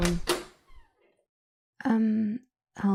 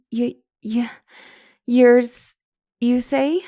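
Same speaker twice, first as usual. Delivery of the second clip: higher and louder, stuttering in disbelief; stammering shock; 3 semitones higher, 4 dB louder than usual. Acted. Counselor shocked that her client is a vampire, stammering. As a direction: gasp out the words, stunned.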